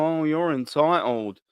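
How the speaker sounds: slow, drawn-out sneer at a critic; mocking whiny critic. Fan mocks critics calling him entitled, in a slow sneer. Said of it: "people might go".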